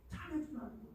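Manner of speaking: 잘해주라는 said high and very soft. Shown as a demonstration.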